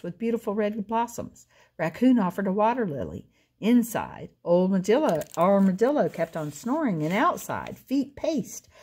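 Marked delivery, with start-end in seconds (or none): none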